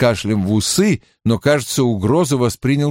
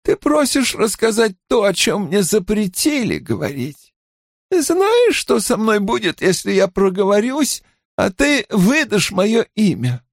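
high-pitched, agitated protest; pitched-up, anxious character voice; alarmed healer woman; 10 semitones higher than usual. Acted. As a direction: protest as a woman afraid to talk. Narrator voices frightened, indignant woman.

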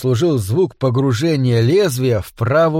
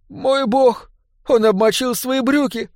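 gasped dismay; 10 semitones higher than usual. A narrator's reading. Woman at next table notices the wet trousers, gasping. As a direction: gasp it in a high, hushed woman's voice. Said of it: "ахнула женщина за соседним столиком".

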